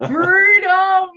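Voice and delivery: high, loud shout of freedom; jokey rousing yell; mock battle cry. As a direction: holler it like a rallying cry.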